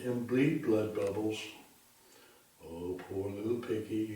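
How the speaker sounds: ordinary delivery, nothing marked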